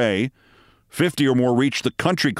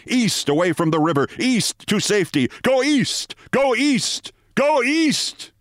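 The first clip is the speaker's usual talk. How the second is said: rising, ever higher urgent calls; panicked crying out; 9 semitones higher than usual. A narrator's reading. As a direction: build the panic with each cry.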